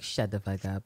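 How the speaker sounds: dropped low and soft, deadpan; low deadpan snap. Retold or imitated. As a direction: mutter it cold and low. Imagined retort, low and far.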